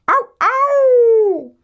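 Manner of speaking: high, loud mock dog yelp; dog howl. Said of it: "then the dog said".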